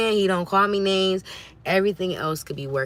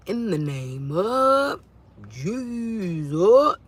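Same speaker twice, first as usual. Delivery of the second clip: slow, drawn-out preacher-style invocation; hammed-up church rebuke, long held vowels; rebuking the devil; 4 semitones higher, 4 dB louder, much slower than usual. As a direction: stretch each word, rising higher and louder. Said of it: "you have to learn to rebuke".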